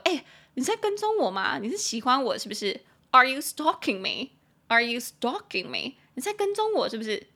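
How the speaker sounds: high-pitched teasing questions; joking accusation. Pretend. Running into a coworker everywhere, playful mock accusation. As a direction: tease them with mock suspicion. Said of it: "可以拿来开玩笑".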